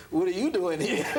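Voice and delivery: high, laughing comeback; laughing retort. Real, not acted. Answering [name]'s question while laughing. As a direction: toss the question back through laughter.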